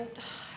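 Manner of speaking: put-on exasperated sigh; weary sigh. Guest stuck talking to Carabas, mock weary sigh. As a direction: sigh as if dreading a chat. Imagined dread of party bore.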